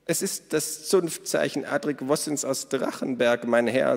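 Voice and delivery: hohe, laute Stimme einer lebhaften Frau; Minnas Stimme. A narrator's reading. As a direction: Minna ruft die Gäste fröhlich zum Essen. Narrator voices Minna. (hohe, laute Frauenstimme)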